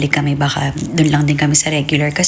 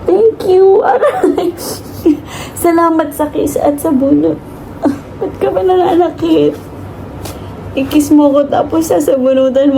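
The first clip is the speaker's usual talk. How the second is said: laughing complaint; 8 semitones higher than usual. Real, not acted. Mother laughing as baby kisses and pulls hair, high pitched. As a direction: laugh through the pain.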